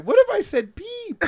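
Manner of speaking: high mimic of Babette's "peep"; imitating Babette. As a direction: echo "What if I said peep?" high and cute. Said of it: "Babette saying".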